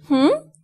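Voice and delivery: short hum rising sharply; questioning hmm. Shown as a demonstration. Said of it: "indicates the sentence is a question".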